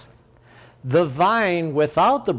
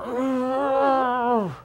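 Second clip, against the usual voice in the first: high strained grunt of effort; straining grunt; 9 semitones higher than usual. Retold or imitated. Preacher mocks a struggling branch with a strained grunt. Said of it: "the branch is sitting there going".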